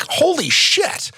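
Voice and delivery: amazed. Real, not acted. Marvelling at his comedy career.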